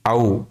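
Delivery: "आउ" as one slow gliding vowel; diphthong sounded out. Shown as a demonstration. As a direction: glide from ah to oo on "आउ". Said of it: "O यू को आउ".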